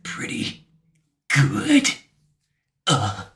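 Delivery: hushed grudging words, then a pained grunt; Ocelot, grudging. Retold or imitated. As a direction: breathe it out while beaten. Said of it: "Ocelot grabs his arm and he's like".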